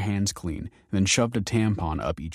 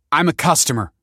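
snapping; 8 semitones higher, 6 dB louder than usual. Acted. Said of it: "Kelsey snapped".

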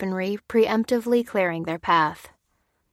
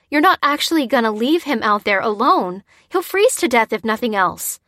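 raised, louder, indignant appeal; protesting; 4 semitones higher, 6 dB louder than usual. A narrator's reading. Lucy protesting to the men, indignant. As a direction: argue in disbelief. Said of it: "she protested".

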